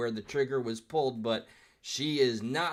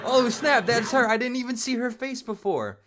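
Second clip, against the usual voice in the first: loud, high burst of real surprise; surprised shout; 11 semitones higher, 9 dB louder than usual. Real, not acted. Reactor recognizes the heckler on screen, shouting in surprise. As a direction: snap out a sudden realisation, high and loud.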